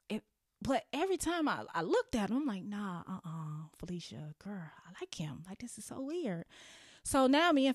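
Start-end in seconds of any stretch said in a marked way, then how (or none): 2.6-6.4 hushed whisper of a secret crush; retold whisper. To her friend Felicia in class, admitting a crush, whispering.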